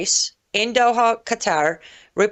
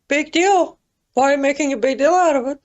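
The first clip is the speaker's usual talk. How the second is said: higher-pitched dismissive mimicry; mock dismissive; 6 semitones higher, much faster than usual. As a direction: wave it off in a mocking higher voice.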